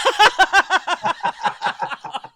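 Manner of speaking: high, loud burst of laughter; shrieking laugh. Real, not acted. Explodes laughing.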